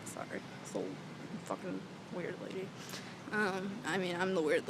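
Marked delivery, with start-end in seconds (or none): 0.1-2.7 hushed, much softer aside; softly whispered aside to passers-by. Real, not acted. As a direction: whisper an embarrassed apology. Apologising to people passing outside.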